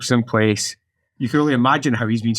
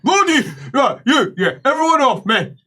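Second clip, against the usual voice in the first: high-pitched, brusque orders; bossy helper; 12 semitones higher than usual. Acted. Helper ordering everyone off, acted brusque voice. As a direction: clear everyone away from you. She takes charge.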